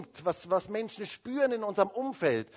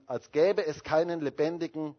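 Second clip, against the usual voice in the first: normal talking voice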